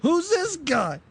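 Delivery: jealous demand. Acted. Jealous man confronts woman's date.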